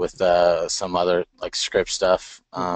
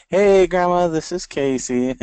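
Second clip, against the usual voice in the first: high, sweet and louder; grandma voice; 11 semitones higher, 4 dB louder than usual. Shown as a demonstration. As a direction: talk like you're calling your grandma. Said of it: "my buddy calls it my grandma voice".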